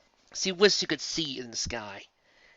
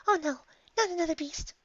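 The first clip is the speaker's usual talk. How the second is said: much higher, trembling dread; fearful whimper. Acted. Fluttershy dreading another beast. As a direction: whimper in fear.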